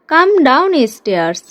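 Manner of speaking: slow, drawn-out teacher repeat. Shown as a demonstration. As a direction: stretch each word for the learner. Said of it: "teacher repeats the phrase slowly". (ধীরে ধীরে স্পষ্ট উচ্চারণ)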